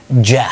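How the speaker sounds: loud clear ja sound; d plus y as j. Shown as a demonstration. Teacher showing the merged d y sound. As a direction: stress the ja. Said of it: "صوتها بيبقى جه".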